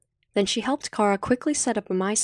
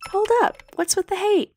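lightly voiced girl, much higher, protesting; Cindy protesting; 14 semitones higher than usual. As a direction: pitch up for a friend stopping the insults. Cindy voiced in chat scene.

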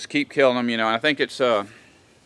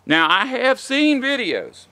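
ordinary talk, nothing marked

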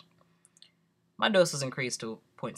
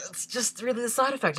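mimicking the nurse; 9 semitones higher than usual.

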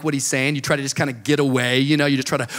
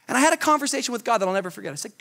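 ordinary delivery

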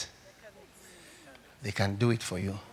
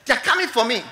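loud, high terrified wail; frightened cry; 5 semitones higher, 8 dB louder, faster than usual. Retold or imitated. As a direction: cry out as if spirits come for you. Dying man's cry repeated.

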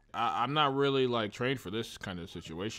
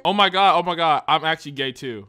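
hammed-up excitement; 4 semitones higher, 12 dB louder than usual.

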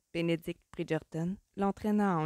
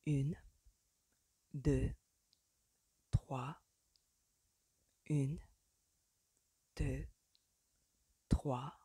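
soft, slightly lowered counting, evenly spaced; susurrated count; 3 semitones lower, 4 dB quieter, much slower than usual. A narrator's reading. Man guiding his partner to the music, soft murmur. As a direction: murmur the beat close to the ear. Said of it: "susurrait à son oreille".

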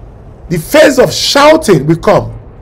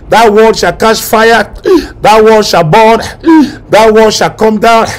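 declaration; 3 semitones higher, 4 dB louder than usual. Acted. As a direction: proclaim each line like a decree. Prophetic declaration.

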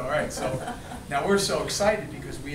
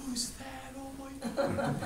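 much higher and softer, breathless with awe; starstruck fan; 11 semitones higher, 7 dB quieter, slower than usual. Retold or imitated. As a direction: sigh it like a boy falling in love. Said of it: "every other guy in the audience was like".

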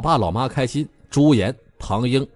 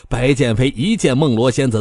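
ordinary delivery, nothing marked

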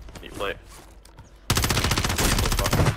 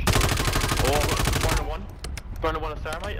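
excited; 7 semitones higher, much slower than usual. Real, not acted. Excited callout in firefight.

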